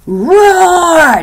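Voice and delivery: a mock growl for the lion card; lion roar. That's the lion.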